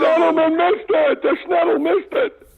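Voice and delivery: loud, high mock call; mock announcer shout. Mock-calling a missed putt.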